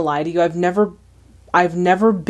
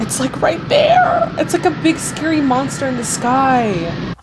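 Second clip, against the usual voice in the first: alarmed reaction; 6 semitones higher than usual. Real, not acted. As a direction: squeal in alarm at the looming monster. Reacting to approaching film tornado.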